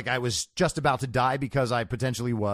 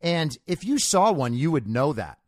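louder, higher lecturing retort; mock know-it-all, dropping in pitch at the end; know-it-all sceptic; 5 semitones higher, 4 dB louder than usual. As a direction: correct them like a smug pedant. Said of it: "And he goes".

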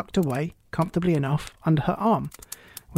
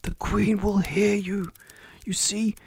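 higher, hushed, nervous telling; frightened whisper; 4 semitones higher, 3 dB quieter, slower than usual. A narrator's reading. Rabbit explains to Alice, frightened and hushed. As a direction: confide it fearfully, as if overheard. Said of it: "whispered in a frightened tone".

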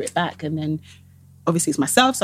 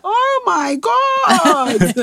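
high, long drawn-out appalled cry; hammed-up shock breaking into laughter; mock horror; 12 semitones higher, 4 dB louder, much slower than usual. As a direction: stretch every word in comic dismay. Horrified reaction to picture, retold.

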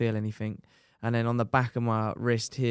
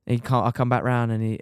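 usual speaking voice

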